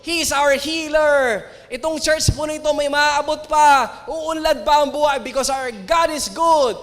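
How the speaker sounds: loud, rising retold preaching, all in; reliving the big sermon, pouring it out loud; preaching at full force. Pastor at a church anniversary, booming preaching. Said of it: "Talagang ibinuhos ko".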